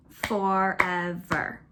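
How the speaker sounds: Forever said very slowly, syllable by syllable; teacher counting syllables aloud; clapping out syllables. Shown as a demonstration. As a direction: clap out "Forever" slowly, one beat per syllable. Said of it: "let's clap that one out".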